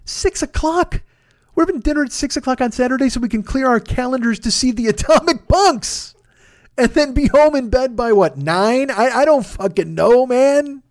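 high, disbelieving comic rant; building outrage at the early plan; exasperated rant. Real, not acted. Ranting to listeners about his friends' early dinner, high and exasperated.

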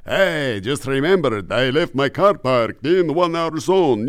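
put-on light Lugosi vampire accent; Bela Lugosi vampire. Greg the Vampire in a light Lugosi voice. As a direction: slide the words like old Dracula. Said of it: "it starts with Bela Lugosi".

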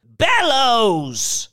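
high, loud, indignant cry; high, drawn-out outburst. A narrator's reading. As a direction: shout the name in outrage. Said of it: "she cried".

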